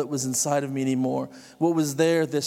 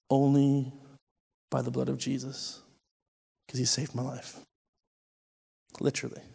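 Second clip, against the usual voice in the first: hushed testimony; 5 dB quieter than usual. Real, not acted. Sharing his testimony with the congregation, hushed. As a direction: drop to a near hush.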